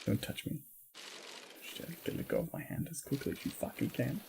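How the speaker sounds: whispering. Pretend. Voicing moderator, whispered.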